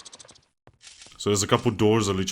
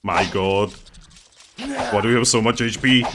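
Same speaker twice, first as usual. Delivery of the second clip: usual speaking voice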